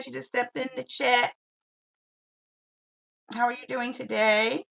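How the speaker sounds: ordinary talk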